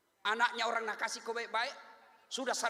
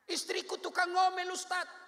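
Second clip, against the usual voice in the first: complaining husband; 8 semitones higher, 4 dB louder than usual. Retold or imitated.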